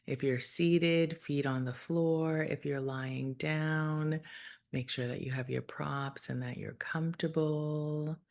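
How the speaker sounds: calm, measured meditation voice with long pauses; guided meditation. Real, not acted. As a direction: gently guide listeners through breath and imagery, unhurried.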